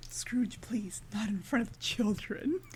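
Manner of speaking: mock whispered reproach; hushed plea. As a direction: plead under your breath, imitating a discreet scolding. Santa quietly scolding Scrooge in retelling.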